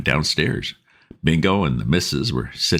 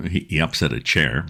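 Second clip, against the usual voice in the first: ordinary delivery, nothing marked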